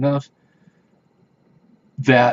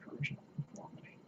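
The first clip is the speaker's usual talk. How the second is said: barely audible, faster whisper; 26 dB quieter, much faster than usual. Real, not acted. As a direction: breathe it out quickly to yourself. Repeats it under his breath.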